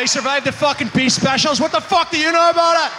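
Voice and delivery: mock outrage. Ribbing the co-host about survival, mock furious shouting.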